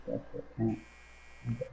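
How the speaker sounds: ordinary delivery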